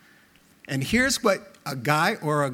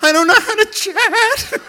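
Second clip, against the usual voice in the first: much higher, louder, laughing; panicky high squeak; 14 semitones higher, 8 dB louder than usual. Retold or imitated. Husband told to chat with wife, high panicky squeak. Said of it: "I'm like".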